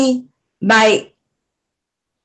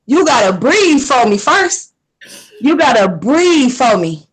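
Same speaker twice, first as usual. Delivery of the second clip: low, slow, calming coax; slow reassuring words to help her breathe; soothing; 6 semitones lower, slower than usual. Real, not acted. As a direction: talk her down gently, slowly. Comforting a crying guest.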